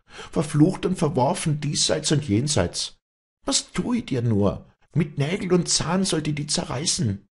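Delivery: high, impassioned reproach; anguished cursing. A narrator's reading. Walli curses Vinzenz for the cowardly murder, high and anguished.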